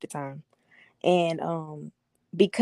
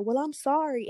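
higher-pitched mock-tearful apology; playing victim; 7 semitones higher than usual. Girlfriend dodging blame with an apology, playing victim. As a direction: plead innocence in a put-upon, raised voice. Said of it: "And I started crying, like, playing victim".

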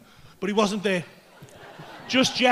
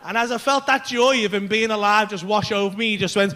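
ordinary delivery

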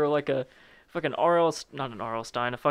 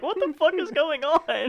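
high-pitched baffled cry; acted confusion, pitched way up; mock bewilderment; 13 semitones higher, 3 dB louder than usual. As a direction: squeak it in hammed-up disbelief. Bewildered onlooker in acted scene.

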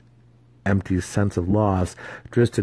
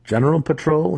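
higher, probing question; pry lightly; 9 semitones higher, 3 dB louder than usual. A narrator's reading. Zack questions Drizzt.

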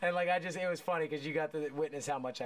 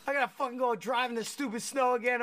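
higher, louder irritated complaint, retold; pissed-off grumble; 7 semitones higher, 4 dB louder than usual. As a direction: gripe about driving in the snow again. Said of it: "I was pissed".

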